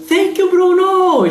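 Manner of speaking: bem agudo, animado, quase gritando; agradeça com entusiasmo; agradecimento empolgado. Real, not acted. Agradece rosas na live. (agradecimento empolgado e agudo)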